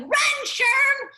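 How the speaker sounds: high, loud shout of a panicking mom; imitated yelling. Mom to dad as she flees, full-voiced imitated yell. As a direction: yell it out as mom urging escape. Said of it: "She's yelling".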